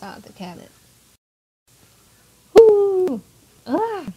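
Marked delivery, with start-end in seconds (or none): 2.5-4.0 loud high "woo" and a short "ah"; high startled whoop. Acted. Player voices a classroom ambush, startled whoop. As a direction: yelp out a high, drawn-out whoop. Reacting to a sudden burst in game.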